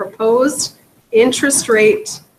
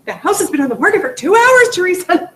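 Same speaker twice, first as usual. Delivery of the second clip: higher and louder, mock outrage at the seller; exasperated retelling; 8 semitones higher, 5 dB louder than usual. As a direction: throw up your hands at the seller's nagging. Exasperated licensee quoting seller.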